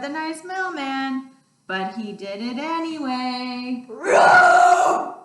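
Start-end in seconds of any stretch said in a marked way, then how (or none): 3.9-5.1 big shouted dinosaur roar, pitched high; loud playful dinosaur roar. Acted. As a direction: roar it out full force. Dinosaur roars at mailman.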